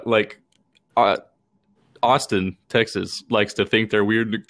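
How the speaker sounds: ordinary talk, nothing marked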